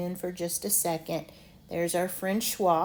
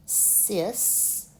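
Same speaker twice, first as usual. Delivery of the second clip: normal talking voice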